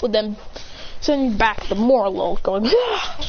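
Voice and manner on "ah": high "ah" yell; attack cry. Acted. Attacks sending the Morlul back, high battle cry.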